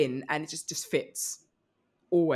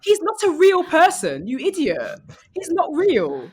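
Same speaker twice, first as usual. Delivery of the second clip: loud and higher, mock indignant at an imagined reader; mock outraged yelling; 11 semitones higher, 10 dB louder than usual. Scolding readers of a fake author, mock outraged yell. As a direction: shout it as if scolding a fool, hammed-up.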